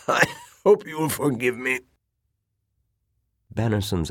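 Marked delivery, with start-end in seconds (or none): 0.1-1.8 high, weak-chested invalid after a cough; strained sick old man. Acted. Coughing admiral asks forgiveness, strained old voice. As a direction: apologise through the tail of a coughing fit.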